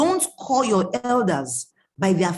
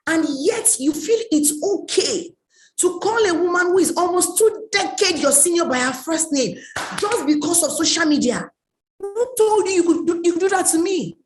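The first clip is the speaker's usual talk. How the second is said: higher, louder outraged disbelief; retold indignant telling-off, louder; indignant scolding; 6 semitones higher, 3 dB louder, faster than usual. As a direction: tell him off, outraged. Scolding a disrespectful young man.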